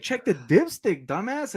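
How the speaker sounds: high, slow put-on father's rebuke; scolding dad. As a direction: talk down to him like a fed-up dad.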